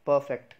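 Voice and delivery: "परफेक्ट" as loud PUH-fekt; punched first syllable, silent R in "परफेक्ट"; correct pronunciation. Shown as a demonstration. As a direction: model "परफेक्ट" without the R. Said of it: "एक बार दोबारा बोलेंगे".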